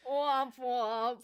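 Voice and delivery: sad trombone voice; womp womp. Pretend. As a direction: droop it like a sad horn. Sound-effect mockery.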